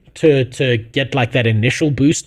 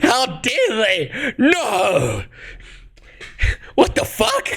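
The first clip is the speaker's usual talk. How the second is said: slow, high mock outrage; hammed-up disbelief, dragged out; 9 semitones higher, 4 dB quieter, much slower than usual. Mocking the streamer's complaint.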